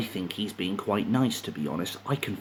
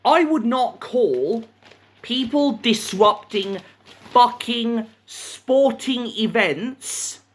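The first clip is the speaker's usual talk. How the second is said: high-pitched, slow, emphatic outrage; real indignation, drawn out for emphasis; indignant rant; 12 semitones higher, 3 dB louder, slower than usual. Real, not acted. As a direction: hammer out each phrase in exasperation. Ranting at newspaper's wording.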